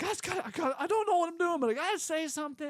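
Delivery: high, loud tearful blurting; mock sobbing, pushing the words out; imitated sobbing. To the pastor at the open mic, imitating a tearful plea. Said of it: "I'm just sobbing everywhere".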